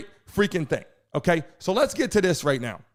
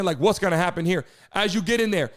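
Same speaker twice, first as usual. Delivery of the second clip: ordinary talk, nothing marked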